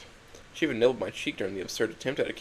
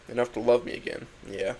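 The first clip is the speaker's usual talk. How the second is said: ordinary delivery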